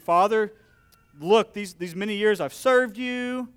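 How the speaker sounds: put-on resentful older son. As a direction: throw years of grievance at father. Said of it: "But he was angry".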